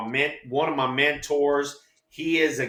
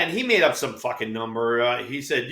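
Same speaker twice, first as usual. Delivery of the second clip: usual speaking voice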